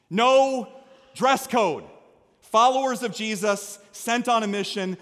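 normal talking voice

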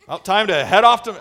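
lightly put-on southern cowboy accent. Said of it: "I always go into a southern accent when I do that".

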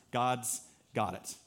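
usual speaking voice

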